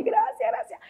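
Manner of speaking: high, softened mock-crying thanks; llanto imitado. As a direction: imitate a tearful, squeaky thank-you. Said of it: "Y lloraba así". (imitando un llanto agudo y bajito)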